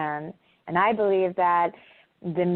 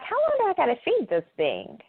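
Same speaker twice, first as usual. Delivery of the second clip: daughter's whine; 10 semitones higher, faster than usual. Retold or imitated. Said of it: "she's like".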